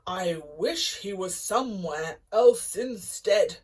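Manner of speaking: loud booming giant roar. A narrator's reading. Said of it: "roared the giant".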